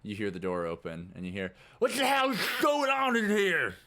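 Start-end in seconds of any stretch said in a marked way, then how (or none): 1.8-3.8 loud, high bark of outrage; angry guard. Acted. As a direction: storm in demanding answers. Guard bursting in angrily.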